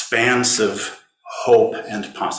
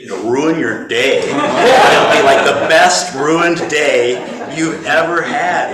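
slow, louder laughing delivery; amused laugh at a ruined day; 4 semitones higher, 7 dB louder, slower than usual. Real, not acted. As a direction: laugh as you say it. Laughs about sad song.

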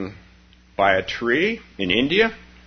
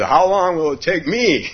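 worried plea; 8 semitones higher, 4 dB louder than usual. Acted. Worried second meditator asks.

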